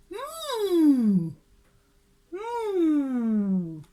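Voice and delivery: loud mooing cow. Shown as a demonstration. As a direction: moo like a cow.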